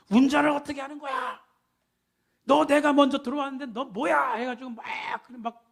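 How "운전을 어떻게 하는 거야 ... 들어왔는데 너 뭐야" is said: raised, higher-pitched angry demand; acting out a driver shouting blame; loud road-rage quote. Preacher imitating a driver after a crash, mock angry shouting. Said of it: "목소리 큰 사람이 이긴다고".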